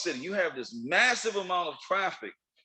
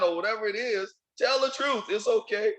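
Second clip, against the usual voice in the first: ordinary delivery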